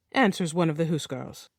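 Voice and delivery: plain reading voice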